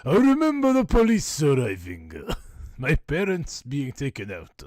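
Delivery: high, loud put-on voice; shrill comic character voice; Lou. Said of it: "This probably should all be in Lou's voice".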